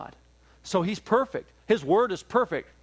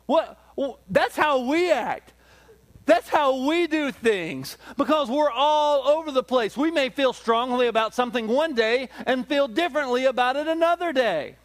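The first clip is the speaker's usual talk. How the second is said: fervent preaching; 8 semitones higher, 4 dB louder than usual. Acted. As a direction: drive the point home to the congregation. Preaching at full force.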